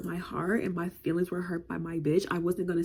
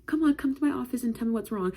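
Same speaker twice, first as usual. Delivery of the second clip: coaxing, higher adult voice; caring principal; 6 semitones higher than usual. Retold or imitated. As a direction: gently coax a crying kid. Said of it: "And she's like".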